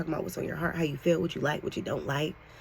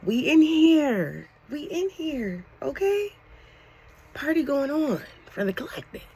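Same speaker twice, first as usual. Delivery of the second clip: high, loud, drawn-out celebration; whooping with excitement, high-pitched; excited; 11 semitones higher, 4 dB louder than usual. Real, not acted. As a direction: hype it up like a party starting. Excited shout at start of reading.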